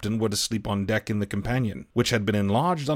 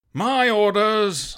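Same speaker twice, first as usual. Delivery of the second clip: higher, slow clipped command; curt captain; 9 semitones higher, 3 dB louder, much slower than usual. A narrator's reading. Captain shuts down the cook's protest, curt. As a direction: cut him off with two words. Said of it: "said the captain shortly".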